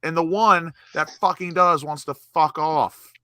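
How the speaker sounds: rising to a high, loud furious rant; angry rant. Real, not acted.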